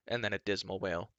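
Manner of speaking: ordinary delivery, nothing marked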